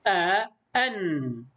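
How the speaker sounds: آ، أن slowly, the second closed on n; "a", then "an" with a held nun; vowel, then tanween. Shown as a demonstration. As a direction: spell out آ، أن slowly for pupils to copy. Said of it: "إن كان عليها فتحتان فنقول".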